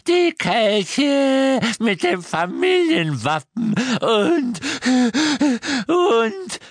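slow, high, loud croak climbing toward a breathless wail; a house-elf grieving his mistress's stolen heirlooms; croaking elf, rising distress. A narrator's reading. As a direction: list the stolen treasures as if each one wounds. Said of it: "Creature schnappte nach Luft".

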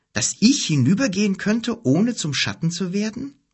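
put-on a boy asking the wizard in disbelief; incredulous boy. A narrator's reading. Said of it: "boy Kim asking incredulously".

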